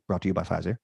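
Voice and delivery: low, soft sponsor tag read; ad announcer voice. Pretend. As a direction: read it like a quiet commercial disclaimer.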